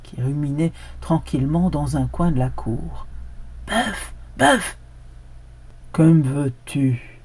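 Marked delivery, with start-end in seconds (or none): 3.7-4.8 a high call to the ox. A narrator's reading. The dog calls the ox with a high cry. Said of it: "le chien appela le bœuf". 5.9-7.2 slow, drowsy ox; a lazy bellow from a dream; lowing ox. A narrator's reading. The disturbed ox lows a slow answer to the dog. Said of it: "mugit le bœuf".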